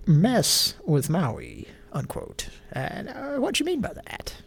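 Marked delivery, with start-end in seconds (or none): 0.1-1.7 "mess with Maui" said very slowly and deliberately; quoted phrase slowed. Real, not acted. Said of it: "quote ... unquote".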